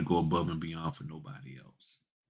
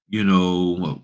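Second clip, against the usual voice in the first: ordinary delivery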